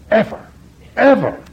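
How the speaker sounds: "Ever. Ever." each high, hammered twice; stress on ever. Real, not acted. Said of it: "No one ever spoke to me like that".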